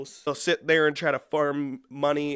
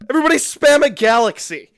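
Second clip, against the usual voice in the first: high, loud hyped-up call; imitating a pushy livestreamer; mock streamer shout; 8 semitones higher, 9 dB louder than usual. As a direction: shout it like you're rallying the chat. Mimics streamers begging for gifts.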